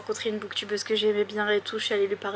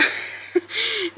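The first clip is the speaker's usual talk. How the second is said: burst of laughter; 10 semitones higher than usual. Real, not acted. Laughs at own stumble.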